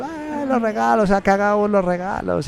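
voz aguda y lenta de niño asombrado; niños ilusionados. Retold or imitated. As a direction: imita a un niño que descubre los regalos. (voz muy aguda de niño sorprendido)

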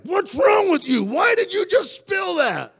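high, loud reenacted outburst; furious parent yell. Father yelling at his child over a spilled glass, furious. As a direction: blow up at the child, voice shooting up. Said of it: "I would get really mad".

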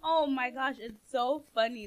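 ordinary talk

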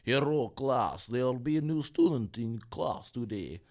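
slow mock Ukrainian teacher; Ukrainian accent. Teacher greeting her class in a Ukrainian accent. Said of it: "Ukrainian teacher".

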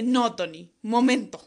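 re-enacted shouted protest; retold yelling. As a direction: cry out loud to make him stop. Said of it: "Empecé a gritar y dije".

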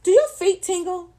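high, loud commercial announcer parody; mock TV pitch, big and high; loud and proud. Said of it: "all loud and proud".

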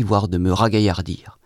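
ordinary delivery, nothing marked

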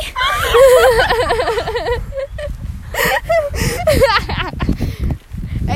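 high screaming laughter; a long helpless laughing shriek; loud shrieking laugh. Real, not acted. Laughing fit. (lautes kreischendes Lachen)

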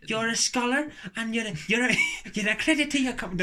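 high, loud, wobbly Irish-sounding impression; accent slipping into Irish. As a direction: attempt an impression that drifts into Irish. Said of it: "Then I've gone Irish again".

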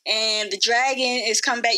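ordinary talk

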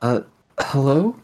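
tentative call into the dark, rising. Acted. Calling out into darkness.

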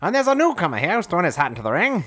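put-on high, fast old-timey announcer patter. Asked by a teammate to act out the idiom, old-timey voice. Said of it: "do your old-timey voice".

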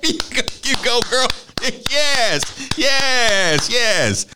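laughing cheer. Retold or imitated.

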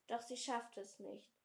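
ordinary delivery, nothing marked

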